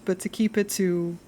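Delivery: normal talking voice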